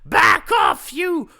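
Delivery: loud, high shout. Acted. Waiter shouting at the old lady, loud shout. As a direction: chase her off at the top of your voice. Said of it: "turned on the old lady and shouted".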